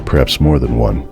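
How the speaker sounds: ordinary delivery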